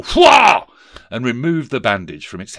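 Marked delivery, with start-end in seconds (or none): 0.0-0.7 very loud, high ghost wail; terrible ghostly cry. A narrator's reading. Ghost frightens Scrooge, terrible high cry. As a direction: let out a sudden huge cry. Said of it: "the ghost raised a terrible".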